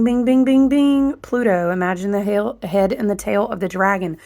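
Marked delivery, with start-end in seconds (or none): 0.0-1.1 pinball bing. Pretend. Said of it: "it's going bing".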